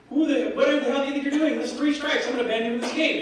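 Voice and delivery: high-pitched exasperated tirade; retold outrage. Lord British catches the thief a third time, high and outraged.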